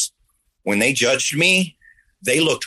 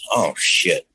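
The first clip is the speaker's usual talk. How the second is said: ordinary talk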